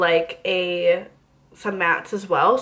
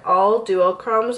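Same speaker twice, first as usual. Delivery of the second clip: normal talking voice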